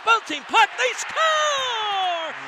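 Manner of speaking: rising to a big goal yell; excited goal call. Real, not acted. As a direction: erupt as the puck goes in.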